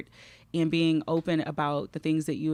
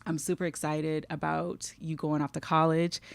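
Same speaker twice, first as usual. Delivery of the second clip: ordinary delivery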